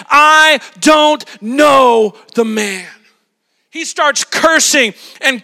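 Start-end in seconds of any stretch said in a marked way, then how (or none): 0.1-2.9 loud, very slow shouted oath; swearing denial. Retold or imitated. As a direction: spit it out like a curse. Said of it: "And he swore to them".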